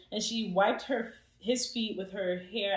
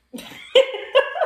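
high laugh; 13 dB louder than usual. Real, not acted. Laughing burst.

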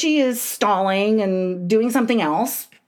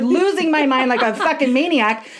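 ordinary talk

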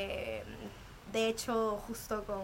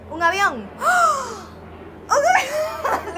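loud, high, delighted cry; excited shout; 13 dB louder, much slower than usual. Real, not acted. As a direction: shout it out as the plane passes. Plane flies over recording.

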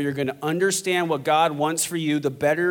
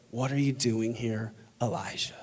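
soft, low, tender question; gentle whisper; 5 semitones lower, 8 dB quieter than usual. Acted. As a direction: drop to a hushed, low voice. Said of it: "the sound of a gentle whisper".

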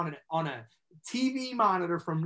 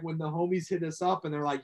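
ordinary talk, nothing marked

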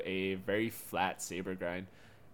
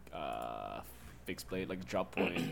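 ordinary talk

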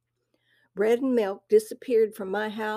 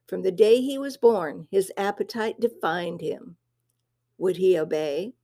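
plain reading voice

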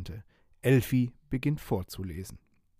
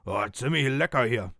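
pirate captain. Acted. Pirate captain with mouth full of strawberries, gruff. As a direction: chew out "ziemlich lecker" like a greedy pirate. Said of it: "Dann sagt er".

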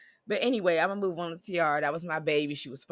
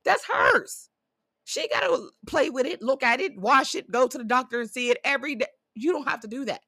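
higher, fired-up retelling of a castmate's comeback; light impression of a castmate defending her friend; quoting Judy; 6 semitones higher than usual. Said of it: "I like that she said at the reunion".

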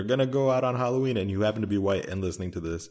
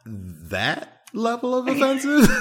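high-pitched, laughing through the words; laughing protest; 13 semitones higher, 5 dB louder than usual. Real, not acted. Laughing at a friend's over-the-top example.

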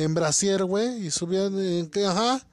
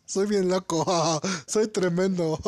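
laughing; slower than usual. Real, not acted. Repeats friend's joke laughing.

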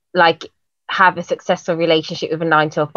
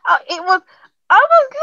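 high and loud, tripping over words; bursting to tell it; breathless high excitement; excited; 12 semitones higher, 8 dB louder than usual. Real, not acted. Excited retelling of surprise.

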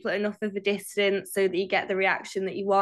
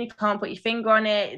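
usual speaking voice

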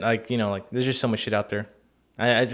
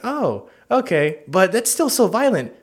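higher, hesitant straight-man voice; mock-worried, pitched up; Tom; 7 semitones higher, slower than usual. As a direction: imitate a meek man objecting. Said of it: "teach Tom".

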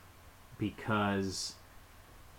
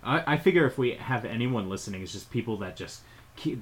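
ordinary talk

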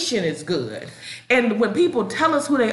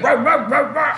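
mock bark; 4 semitones higher than usual. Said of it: "They bark".

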